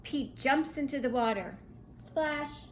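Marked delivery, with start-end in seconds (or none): none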